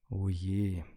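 leise resigniertes Stöhnen; gestöhnt. A narrator's reading. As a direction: mit einem Anflug von Stöhnen. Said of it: "stöhnte ich". (leicht stöhnend)

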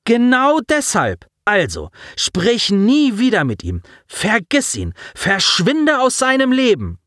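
put-on girl furiously telling a boy to leave her friend alone; furious scolding. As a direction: tear into him word by word. Daya furiously scolds Colin.

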